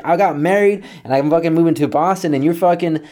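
high, heated retold complaint; acted exasperated accusation; indignant grievance. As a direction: argue back at full pitch. Acted phone quarrel with dad.

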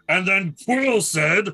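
ordinary delivery

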